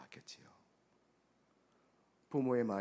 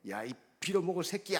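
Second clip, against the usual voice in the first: higher, louder acted curse at the son; retold cursing; 8 semitones higher, 6 dB louder than usual. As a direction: snap a curse at the boy, acted. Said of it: "하고 욕을 하는데". (아침 먹는 아들에게 욕하는 흉내)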